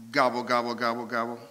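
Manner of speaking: lightly imitated turkey gobbling, only a little voiced; a quick gobble-gobble; mild turkey gobble. Shown as a demonstration. Pastor's animal sound quiz, a light turkey gobble. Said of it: "I'm gonna make a sound".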